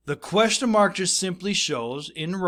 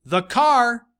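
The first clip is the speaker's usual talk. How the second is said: higher, louder quoted words; emphatic quote; 8 semitones higher, 8 dB louder than usual. Acted. Teacher marking quoted words for students, emphatic. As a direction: lift the quoted part out.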